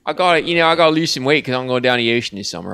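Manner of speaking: put-on a loud Baltimore accent for Santa looking at his belly. Santa talking to his mirror, in a loud Baltimore accent. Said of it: "he'd go".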